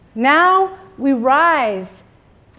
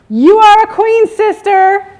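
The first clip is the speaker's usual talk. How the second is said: much higher and louder, a full-throated declaration; like you mean it; 10 semitones higher, 12 dB louder, slower than usual. Shown as a demonstration. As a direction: proclaim it to your partner with total conviction. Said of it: "say it like you mean it".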